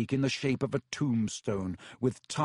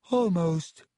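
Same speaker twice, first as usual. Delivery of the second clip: sad; 5 semitones higher, 5 dB louder than usual. A narrator's reading. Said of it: "said the ghost sadly".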